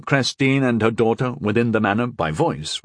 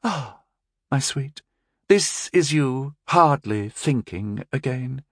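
sarcastic sympathy; 5 semitones higher, slower than usual. A narrator's reading. Mother mocking her daughter, sarcastic sympathy. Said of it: "with sarcastic sympathy".

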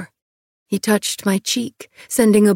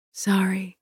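soft murmur under the breath; 6 dB quieter than usual. A narrator's reading. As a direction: murmur it almost to yourself. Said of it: "I murmured".

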